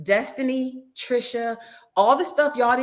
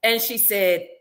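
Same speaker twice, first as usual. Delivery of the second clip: ordinary talk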